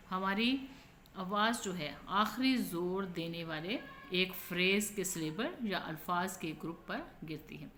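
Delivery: ordinary talk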